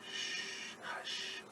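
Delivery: long soft shush, then hush; whispered hush. Acted. Mother hushing animals near sleeping baby, whispered.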